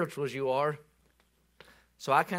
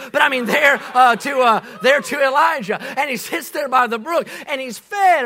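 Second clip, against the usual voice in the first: loud, high preaching build; fiery Southern preacher; preaching at full force; 7 semitones higher, 9 dB louder than usual. Real, not acted. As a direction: preach it out to the congregation. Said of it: "preaching at full force".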